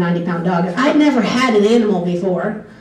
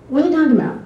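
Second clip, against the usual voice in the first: puzzled disbelief; 7 semitones higher, much faster than usual. Retold or imitated. Host family's puzzled reply.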